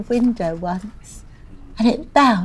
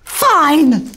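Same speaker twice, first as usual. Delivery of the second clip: high, loud, huffy retort; exasperated snap; 6 semitones higher, 6 dB louder than usual. Acted. Refused the phone call, exasperated snap. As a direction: burst it out in a huff. Gives up pleading, retaliates.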